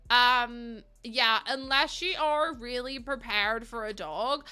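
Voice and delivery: ordinary delivery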